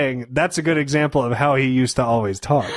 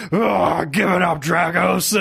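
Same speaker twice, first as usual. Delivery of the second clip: a touch of wrestler bluster, raised; lightly hammed Hulk taunt; 3 semitones higher, slower than usual. Imitating Hulk taunting Dragos, mock wrestler bluster. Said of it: "he'd always just be like".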